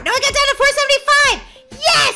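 high, loud excited cheer; triumphant shout. Real, not acted. Gamer got down to four seventy-five, triumphant shout. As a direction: cheer it out at top pitch. Celebrates weight loss in game.

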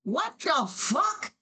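high, drawn-out disbelief; incredulous. Real, not acted. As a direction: stretch the words out high in bafflement. Streamer reacts in disbelief to accent compliment.

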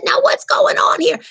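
put-on high old-lady nun asking what's happening. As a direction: play a frail ancient nun. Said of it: "And she said".

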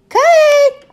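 very loud, piercingly high yell; shout. A narrator's reading. Girl answers the recess whistle last, shouting loudest. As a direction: yell it at the top of your lungs. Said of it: "I shouted".

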